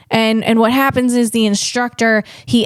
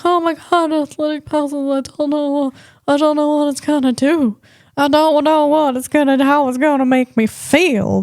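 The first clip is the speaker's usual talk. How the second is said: lightly raised, fussing worried voice; mock nervous fretting; 4 semitones higher than usual. Joking to viewers about trying energy pouches, mock nervous. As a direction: play your own jittery worry, half-joking. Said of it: "I'm such a baby. I'm like".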